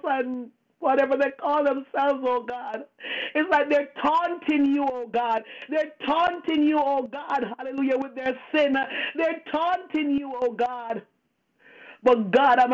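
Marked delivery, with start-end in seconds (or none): none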